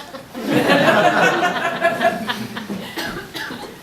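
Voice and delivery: loud high-pitched cackle; high laugh. Real, not acted. Comedian laughing after the pissed joke. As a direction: crack up laughing.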